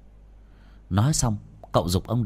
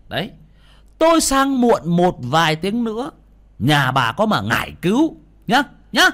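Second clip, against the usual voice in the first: loud, higher berating, climbing to a shout; angry scolding; 7 semitones higher, 6 dB louder than usual. Acted. Exorcist berating the wife whose greed brought the demon, angry. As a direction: lecture her hard, pointing at her face. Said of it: "mà mắng".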